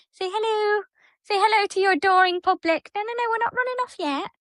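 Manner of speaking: high sing-song voice for the cat; pet talk. Real, not acted. Host cooing high to her cat Bandit.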